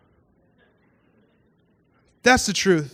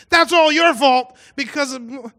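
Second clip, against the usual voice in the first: retold yelling; 6 semitones higher, slower than usual. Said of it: "screaming at my wife".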